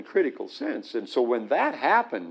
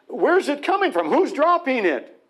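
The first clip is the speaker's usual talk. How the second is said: much higher, urgent astonished questions; amazed questions; 10 semitones higher, 3 dB louder than usual. Retold or imitated. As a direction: ask as if you can't believe it.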